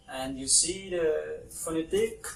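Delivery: normal talking voice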